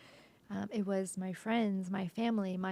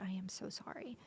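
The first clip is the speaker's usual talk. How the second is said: whispered, much softer apology; 11 dB quieter than usual. Retold or imitated. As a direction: breathe a sorry to the guard. Apologizing to the security guard.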